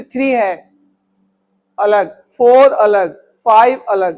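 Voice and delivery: slow, separated reading, each अलग set apart; digit by digit. Shown as a demonstration. Teacher showing the class how to read digits separately, slow. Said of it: "अलग-अलग पढ़ूंगा मैं उसको".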